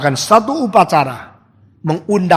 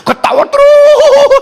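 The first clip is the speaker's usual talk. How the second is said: slow high cry; 7 dB louder, slower than usual. Acted. Endless divine laughter.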